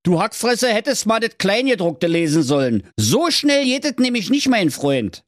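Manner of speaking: high, sneering king voice, snatching the paper; mocking king. King taunts Schnitzelschorsch, high sneering voice.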